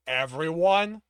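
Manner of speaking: loud, emphatic one-word answer; hint of exasperation. A narrator's reading. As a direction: let some exasperation slip into a forceful reply. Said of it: "hint of exasperation slipping in".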